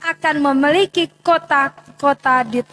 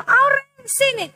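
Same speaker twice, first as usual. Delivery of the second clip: put-on mother calling child; 9 semitones higher than usual. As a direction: call the kid over like a mum. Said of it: "anak-anak kalau baru panggil". (suara ibu memanggil anak, tinggi dan lambat)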